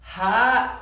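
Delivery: high, strained hi as the smile drops; smile gone. Retold or imitated. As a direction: imitate a mom's forced, displeased hello. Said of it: "her smile immediately went away. It was like this".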